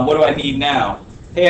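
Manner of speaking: ordinary talk, nothing marked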